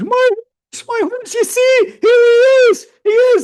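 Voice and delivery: high, loud, drawn-out mock scream; top-of-lungs cry. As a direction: act out the villager wailing with all your lungs. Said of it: "cried at the top of his lungs".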